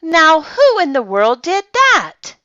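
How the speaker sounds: high, loud demand; startled exclamation. A narrator's reading. Night watchman hears a crash in the store, startled exclamation. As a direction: exclaim it loudly, pitched well up. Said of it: "he exclaimed".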